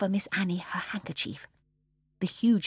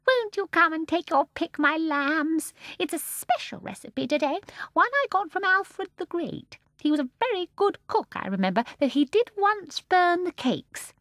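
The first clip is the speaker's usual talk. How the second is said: sweet voice; 11 semitones higher, 4 dB louder than usual. A narrator's reading. Mrs Corrie beckoning the children, sweetly. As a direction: switch to honey.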